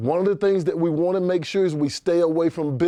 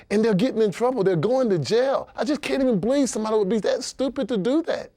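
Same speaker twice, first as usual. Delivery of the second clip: higher and racing at the end in disbelief; exasperated rant; 7 semitones higher, faster than usual. Acted. Accountant venting to client about stupid fakers, exasperated. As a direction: pile on the disbelief until it spills out fast.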